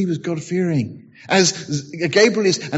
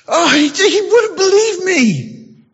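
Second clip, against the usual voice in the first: high, slow, mock-crying complaint; high comic sob reporting failure to the Lord; whining Gabriel; 13 semitones higher, 5 dB louder, slower than usual. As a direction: whimper it like a hurt child. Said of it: "I can just imagine Gabriel".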